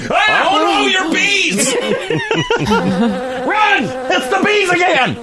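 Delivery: high squealing fake panic; mock panic. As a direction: shriek in comic terror.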